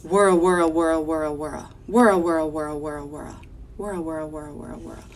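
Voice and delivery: whirl like a machine winding down; whirring machine. Acted. Teacher reads shoe repair sound to children, rolling whirr. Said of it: "Here I see the sound".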